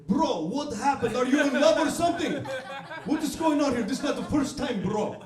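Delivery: put-on loud, high Arabic-accented attendant, laughing; Arabic accent, exasperated and loud. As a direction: play an angry Arabic gas station attendant. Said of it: "all my accents that I do in comedy are Arabic people".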